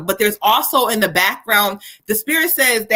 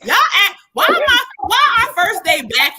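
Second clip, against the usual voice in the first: exasperated squeal; 9 semitones higher than usual. Real, not acted. Exasperated at rowdy callers.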